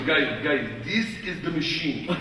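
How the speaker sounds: put-on Russian-accented gangster introduction; Igor. Gangster introducing his friend to new gangsters, Russian-accented voice. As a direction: present him gravely in a Russian accent. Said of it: "he pulls me aside".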